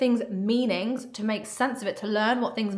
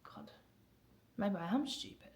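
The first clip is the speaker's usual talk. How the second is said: soft, breathy-quiet realization; a child whispering a painful thought; hushed self-doubt; 12 dB quieter than usual. Retold or imitated. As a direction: say it to yourself, almost inaudible. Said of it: "and you go".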